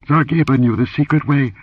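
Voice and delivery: high-pitched, mildly breathless answer; gasped reply. A narrator's reading. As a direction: answer as if just shaken. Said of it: "she gasped".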